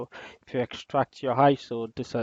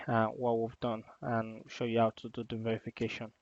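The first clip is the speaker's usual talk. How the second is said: ordinary delivery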